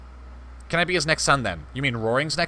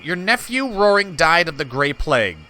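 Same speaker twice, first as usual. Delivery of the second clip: ordinary delivery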